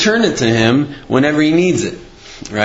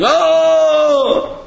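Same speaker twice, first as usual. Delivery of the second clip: mock groan; 13 semitones higher than usual. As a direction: let out a big theatrical groan. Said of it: "he heard the guy groan".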